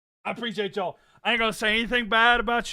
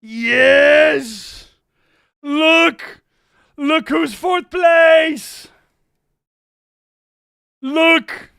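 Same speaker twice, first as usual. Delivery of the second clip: loud, high, drawn-out celebration; real victory holler; ecstatic shouting; 5 semitones higher, 9 dB louder, much slower than usual. Real, not acted. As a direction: yell it in triumph, stretching the words. Celebrating team's rise in standings.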